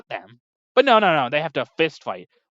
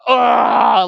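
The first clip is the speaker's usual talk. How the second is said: a sudden loud grunt, high and punched out; pained grunt; 7 semitones higher, 11 dB louder than usual. Retold or imitated. Villain hit by healing hands, a loud pained grunt. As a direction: grunt like you were just hit by a train. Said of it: "and he just goes".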